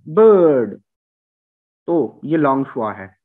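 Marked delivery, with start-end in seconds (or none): 0.1-0.8 बर्ड with a long uh and a silent r; no-r bird. Shown as a demonstration. Teacher drills bird with a long schwa. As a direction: drop the r in बर्ड. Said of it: "इसमें आर कोई नहीं बोला जा रहा".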